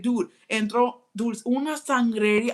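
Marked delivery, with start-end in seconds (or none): none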